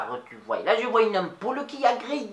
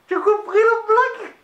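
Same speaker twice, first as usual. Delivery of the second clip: "blague" shouted high and loud; loud high question; 11 semitones higher, 8 dB louder than usual. Acted. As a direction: call out the question high over the room. Asks the audience, loud. (question criée bien haut)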